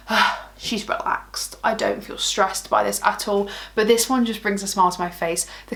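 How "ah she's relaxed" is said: relaxed sigh. Retold or imitated. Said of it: "I'm just like".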